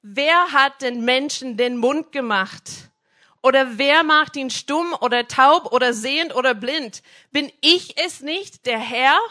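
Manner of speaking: God losing patience, loud; God flipping out. Acted. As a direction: thunder it down at Moses as God. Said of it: "der flippt aus und er sagt".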